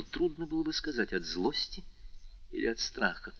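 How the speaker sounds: usual speaking voice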